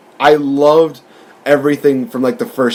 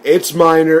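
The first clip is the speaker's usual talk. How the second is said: ordinary talk, nothing marked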